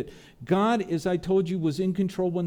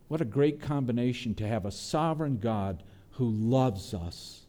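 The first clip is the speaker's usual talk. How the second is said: ordinary talk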